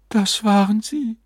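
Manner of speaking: high, wondering woman's voice; awestruck wife. A narrator's reading. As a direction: speak high, overcome by what appeared. Wife awed after spirits vanish.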